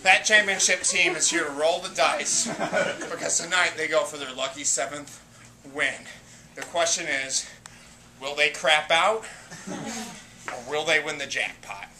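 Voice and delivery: low, hushed build-up for the contenders; dramatic announcer. Acted. Host introducing the championship team, dramatic announcer. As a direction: drop low and soft for suspense.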